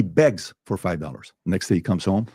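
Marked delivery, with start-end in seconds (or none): none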